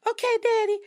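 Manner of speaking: high, loud, sweetly submissive; meek okay daddy. Retold or imitated. Younger [name] answering the man, high and sweetly compliant. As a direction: coo it like an eager girlfriend. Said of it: "[name] back then was like".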